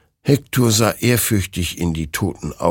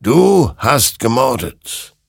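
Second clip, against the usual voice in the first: slow, loud, raised accusation; stern otherworldly judgement; accusing phantom; 6 semitones higher, 5 dB louder, slower than usual. Acted. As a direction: pronounce the verdict word by word. Creature accuses Hector.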